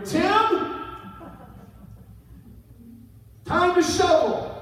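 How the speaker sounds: dad's loud, high summoning yell; calling out. Acted. Dad summons his son to shovel snow, calling out loudly. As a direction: holler for your kid to come work.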